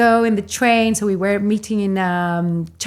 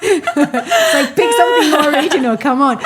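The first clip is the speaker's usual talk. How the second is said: laughing through it, fast and a touch louder; laughing mock scorn; 5 semitones higher, 3 dB louder than usual. Real, not acted. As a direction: scoff at the line while cracking up. Laughing while mocking the pickup line.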